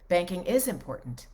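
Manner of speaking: IS punched, falling after it; stress on IS. Shown as a demonstration. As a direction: hit the word is in the middle. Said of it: "emphasis on certain syllables and things".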